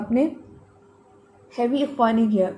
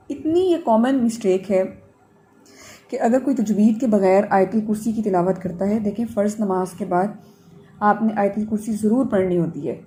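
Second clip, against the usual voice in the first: ordinary delivery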